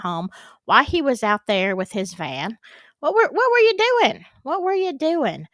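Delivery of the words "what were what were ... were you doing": higher-pitched, incredulous questioning; mock interrogation. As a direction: grill an imagined witness with rising disbelief. Questioning the witness in imagination.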